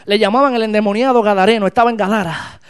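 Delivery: ordinary talk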